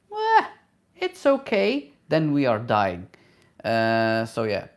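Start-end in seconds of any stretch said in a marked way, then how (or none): 0.1-1.9 high, light mock voice of a coworker; coworker brushing it off. Mimicking a coworker with cold hands, high and dismissive. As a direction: toss it off like it's nothing, pitched way up. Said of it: "when she's saying".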